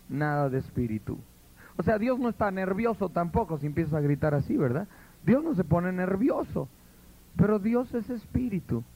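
normal talking voice

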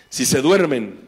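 ordinary talk, nothing marked

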